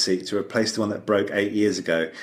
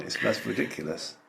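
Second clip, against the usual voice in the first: normal talking voice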